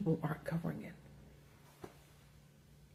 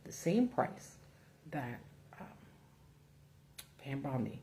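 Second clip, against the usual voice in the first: normal talking voice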